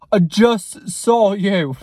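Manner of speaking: slow, loud put-on voice; drawn-out, loud mock confrontation; put-on intimidating voice. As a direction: confront them slowly in a loud character voice. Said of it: "I didn't do it in the voice".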